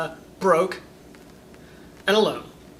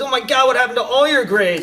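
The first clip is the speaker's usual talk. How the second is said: higher, louder shocked mom; alarmed mom; 7 semitones higher, 7 dB louder than usual. Retold or imitated. A mother's loud alarmed question. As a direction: mom seeing her kid's report card, asking loudly in shock. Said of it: "your mom says".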